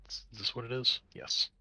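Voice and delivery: normal talking voice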